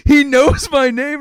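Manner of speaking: put-on loud, slow, high child voice; drawn-out mock wonder, high and loud; amazed little kid. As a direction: gush it as a starstruck kid. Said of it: "Jamie's like".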